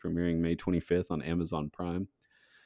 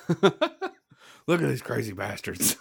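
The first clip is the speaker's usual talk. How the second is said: slow, higher, grinning documentary voice; Gary Busey narrating; 6 semitones higher, slower than usual. Retold or imitated. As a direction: narrate nature with manic glee. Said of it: "Gary Busey needs to do the Murder Hornet documentary".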